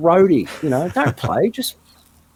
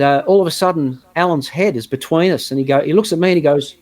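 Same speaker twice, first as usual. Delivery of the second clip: ordinary talk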